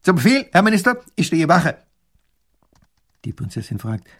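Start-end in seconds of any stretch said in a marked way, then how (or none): 0.0-1.8 high, loud report to a minister; rasping military tone. A narrator's reading. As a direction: report like a soldier at attention. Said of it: "schnarrt wie vorher im militärischen Ton".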